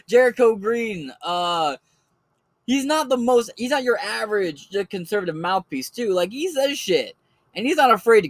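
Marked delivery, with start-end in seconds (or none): none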